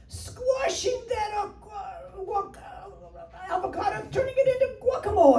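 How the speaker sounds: high, strained squeeze of the words; hammed-up high-pitched effort, stretched out; mock straining. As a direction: squeeze it out high, as if cramming into shapewear. Acting out squeezing into shapewear.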